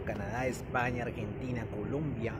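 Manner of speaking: ordinary talk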